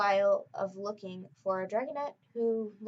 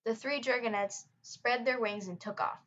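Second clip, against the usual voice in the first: plain reading voice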